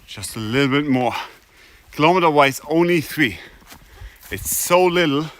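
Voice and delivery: rally yourself up the hill; pep talk. Real, not acted. Self-encouragement on the climb.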